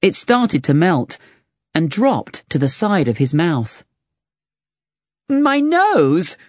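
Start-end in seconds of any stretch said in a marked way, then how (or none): 5.3-6.4 high, shocked cry of dismay; a knight yelping at his melting nose. Acted. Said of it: "cried Lancelot".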